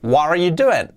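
"What are you doing?" linked, with a melodic fall on "doing"; expressive English question "What are you doing?"; final model intonation. Shown as a demonstration. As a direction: flow through "What are you doing?" as one phrase. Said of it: "Así sería".

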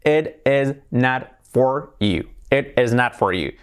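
slow "It is not for you", words separate, E-like "it is", A in "not"; word-by-word "It is not for you" with clear Ts; careful unlinked "It is not for you"; each word pronounced. Shown as a demonstration. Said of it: "tenemos las palabras ya pronunciadas".

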